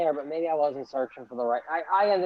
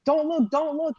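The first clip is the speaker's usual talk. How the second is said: much higher mock voice of a worried neighbor; imitating neighbor; 12 semitones higher than usual. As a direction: pitch it way up, urgent. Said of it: "He's like".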